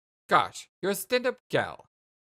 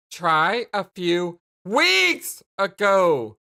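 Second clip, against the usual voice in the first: slow, loud, drawn-out rebuke; pointed correction; 7 dB louder, much slower than usual. Acted. Jane correcting Jake's vague memory, pointedly angry. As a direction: lean hard on the corrected word.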